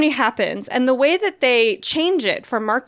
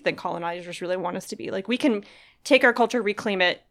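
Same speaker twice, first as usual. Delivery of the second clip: usual speaking voice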